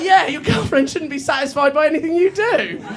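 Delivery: high mock jeer; a hint of a taunting fan. Fan's insult acted out.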